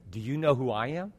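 low, soft, knowing question; quietly pointed. Acted. Jesus looking right into her eyes, quietly pointed. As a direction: drop it low and soft, straight to the soul.

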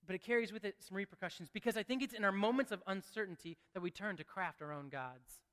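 ordinary delivery

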